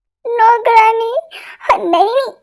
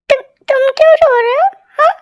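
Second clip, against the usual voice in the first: mock crying; 5 semitones higher than usual. Wife asks through fake tears.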